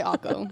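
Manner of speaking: "Akko", taco with its first T gone; joke dropped T. Shown as a demonstration. Joking that Californians skip T's, taco as "Akko". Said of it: "don't say their T's".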